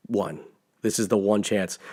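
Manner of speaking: low, very soft acted aside; grave hush. Doctor Strange signals Tony mid-battle, grave hush. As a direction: drop to a solemn murmur.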